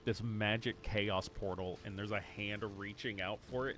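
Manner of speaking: usual speaking voice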